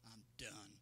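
soft, drained voice of someone giving up; quiet mock surrender to the pastor; weary quitter. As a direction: let it sag like you're finished. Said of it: "throw up your hands and quit and say".

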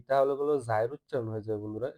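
ordinary talk

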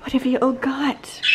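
usual speaking voice

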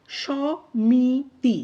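সমিতি drawn out slowly as three separate syllables; syllable-by-syllable demo. Shown as a demonstration. Teacher breaking সমিতি into syllables for students, slow and drawn out. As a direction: stretch each open vowel of সমিতি, pausing between syllables. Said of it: "এইভাবে উচ্চারণটা হয়".

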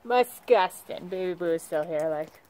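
ordinary delivery

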